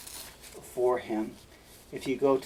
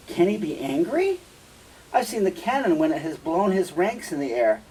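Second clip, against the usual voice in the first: feigned disbelief, pitched higher and louder; Iago; 6 semitones higher, 5 dB louder than usual. Acted. Iago plays unknowing about Othello's anger, mock astonishment. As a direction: act astonished, voice lifted and projected. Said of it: "he plays like he doesn't know".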